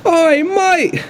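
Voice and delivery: high brash cockney captain voice; cockney pirate captain. Acted. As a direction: bark it like a cocky pirate boss. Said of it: "the man in the chair looks over to you and goes".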